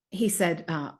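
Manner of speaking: ordinary delivery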